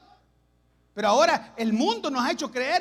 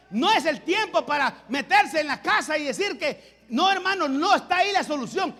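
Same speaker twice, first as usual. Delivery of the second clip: louder, higher pulpit delivery; fiery preaching; 4 semitones higher, 6 dB louder than usual. Acted. Pastor urging his church to trust God, fervent preaching. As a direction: drive the point home with fervor.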